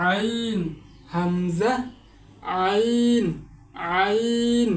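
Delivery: contrasting আইন with হামজা; throat letter demo. Shown as a demonstration. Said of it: "এভাবে উচ্চারণ করতে হবে". (গলার গভীর থেকে আইন)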